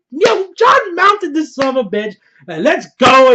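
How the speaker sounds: high, loud thrilled outburst; hyped shout. Real, not acted. Fan cheering Jon riding the dragon, loud and thrilled. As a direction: yell it out, totally pumped.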